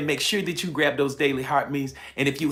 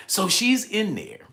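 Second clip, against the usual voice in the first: normal talking voice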